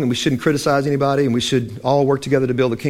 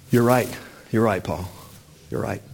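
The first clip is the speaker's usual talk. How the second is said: humble admission; 4 semitones lower, 6 dB quieter than usual. Acted. Peter humbly concedes.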